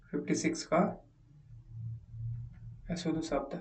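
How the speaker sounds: ordinary talk, nothing marked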